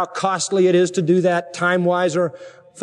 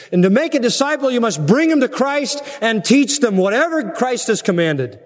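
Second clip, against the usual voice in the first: raised, swooping preacher's voice; forceful preaching; 5 semitones higher than usual. Real, not acted. Preacher to congregation on making disciples, forceful preaching. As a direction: drive each point home from the pulpit.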